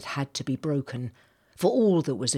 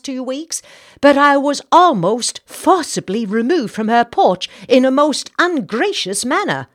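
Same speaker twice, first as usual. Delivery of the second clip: much higher, louder, scandalised lady; indignant society lady; 8 semitones higher, 12 dB louder than usual. Acted. Senator's wife complaining about Missus Mallory, high and indignant. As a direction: huff it out with outrage.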